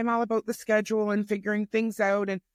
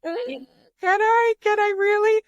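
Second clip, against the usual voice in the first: high, loud, slow unsure whine; hammed-up doubt, dragging it out; mock doubtful; 13 semitones higher, 6 dB louder than usual. Mom answers her son's encouragement with a mock doubtful high whine. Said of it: "I'm like".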